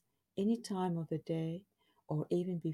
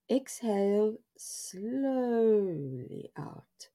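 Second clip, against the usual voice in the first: very slow, stretched-out words; drawn-out breathing cue; 5 semitones higher, 3 dB louder, much slower than usual. Acted. Guiding listeners to empty their lungs, slow and drawn out. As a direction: draw the middle word out long like the breath itself.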